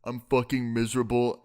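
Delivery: toneless, monotone miserable teacher; Critical. Acted. Miserable teacher droning to his class, Critical-style monotone. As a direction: drone it out in a dead-even monotone. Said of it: "I kinda sound like Critical".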